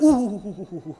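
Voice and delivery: sinners groaning at the sight, dying away; retold groans of dread, trailing off; moaning in horror. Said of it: "they're like".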